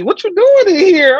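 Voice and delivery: grandma questioning grandson, high and loud. Retold or imitated. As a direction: demand it in a high old-lady voice. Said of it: "she looking at the T V".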